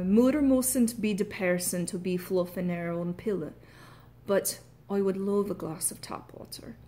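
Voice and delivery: careful Irish key-sentence demo; Irish accent. Shown as a demonstration. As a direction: put on Irish vowels throughout. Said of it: "my key sentence".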